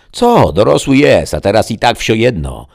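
high, loud husband's protest; indignant retort. A narrator's reading. Father defending giving his son a drink, indignant.

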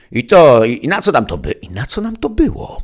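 high-pitched complaining voice; exasperated young woman. A narrator's reading. Martyna's thought during the Gospel reading, exasperated.

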